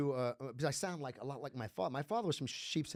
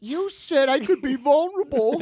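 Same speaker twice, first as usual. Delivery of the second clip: laughing; 12 semitones higher, 16 dB louder than usual. Real, not acted. Laughing complaint.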